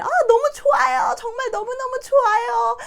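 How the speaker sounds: high, loud cry of delight; overjoyed squeal. Shown as a demonstration. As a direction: squeal with joy like you just won. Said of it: "하는 그 기쁨을".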